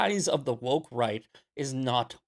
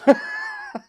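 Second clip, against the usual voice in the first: mock high laugh; 4 dB louder than usual.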